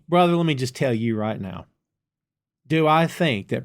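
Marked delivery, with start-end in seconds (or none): none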